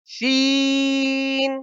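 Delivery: شین stretched, high and hissing like a whistle; شین with a long whistled sh; whistling sheen. Shown as a demonstration. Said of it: "سیٹی کی آواز نکلنی چاہیے".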